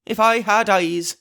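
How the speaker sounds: high, slow anguished cry; bitter cry. Acted. Blind Pugh laments his blindness to crew, bitter. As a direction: wail it in frustration. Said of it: "he cried".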